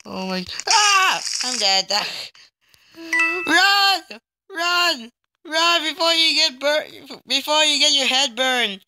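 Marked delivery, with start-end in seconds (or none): 0.7-1.2 sudden high "ah"; startled scream. Real, not acted. Player killed in the game, startled scream. 3.5-8.8 high-pitched urgent shouts of run; urgent warning. Real, not acted. Player warning another to run, urgent.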